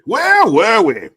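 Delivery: very high, loud, drawn-out mock cry; hammed-up shout. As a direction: blare the question out in a silly high voice. Repeats own question in hammed-up shout.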